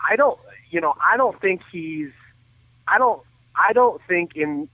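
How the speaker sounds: usual speaking voice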